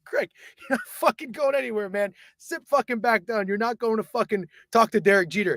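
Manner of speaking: high, strained urging; reenacted panicky scolding; frantic restraint. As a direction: hold a friend back with your voice. Restraining drunk friend.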